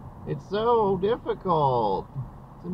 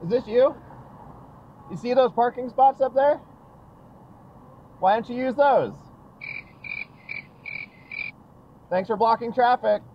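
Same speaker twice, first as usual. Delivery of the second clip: raised, sarcastic shout at driver; loud callout; 8 semitones higher, 3 dB louder than usual. Real, not acted. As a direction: call out the parked car. Calling out at a bad parker.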